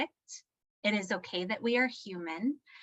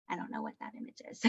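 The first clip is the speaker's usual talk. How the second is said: whispered aside; 10 dB quieter, much faster than usual. Real, not acted. Whispered aside about the image.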